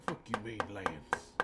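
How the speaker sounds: Lance dropped very low in the movie accent; second try at the movie accent; retry of the accent. Shown as a demonstration. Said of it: "I got the Lance part wrong".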